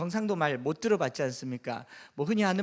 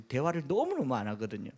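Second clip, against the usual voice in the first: usual speaking voice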